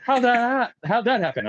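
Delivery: high-pitched, disbelieving squawk; mock incredulity. Superiors hear he was in Mexico, mock incredulity. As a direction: imitate baffled superiors, voice jumping way up.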